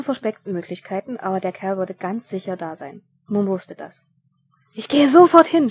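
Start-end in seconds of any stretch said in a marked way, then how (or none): 4.7-5.7 a loud, high shout. Acted. Mumm shouting to Vetinari as he runs off. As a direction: shout it out as he jumps up. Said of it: "schrie er".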